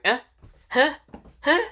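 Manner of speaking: high, startled little yelps; short, spaced, mock-startled grunts; shocked confusion. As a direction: imitate bewildered one-syllable questions. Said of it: "Finro was just shocked".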